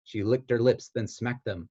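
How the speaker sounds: ordinary delivery, nothing marked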